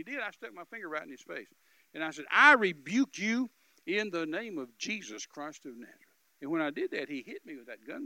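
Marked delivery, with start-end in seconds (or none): 2.3-6.0 loud, slow, deliberate rebuke; preacher rebuking the gunman in Jesus' name, slow and loud; slow forceful rebuke. Retold or imitated. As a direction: rebuke him with full spiritual authority, every word weighed.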